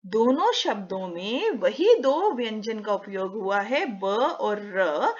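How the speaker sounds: normal talking voice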